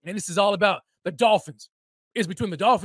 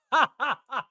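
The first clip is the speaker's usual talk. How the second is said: gloating laugh; 6 semitones higher than usual. Real, not acted. Gloating over Dolphins' loss.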